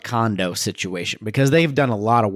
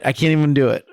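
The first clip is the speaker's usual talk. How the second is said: usual speaking voice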